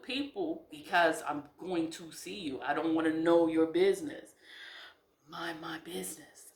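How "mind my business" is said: soft unvoiced reminder; a private whispered self-reminder. Real, not acted. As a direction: whisper it to yourself. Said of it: "I have to tell that to myself".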